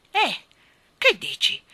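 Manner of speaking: high, loud, affronted cry; offended outburst. A narrator's reading. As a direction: react with a start, deeply offended. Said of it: "scattò il re offeso". (scatto offeso del re)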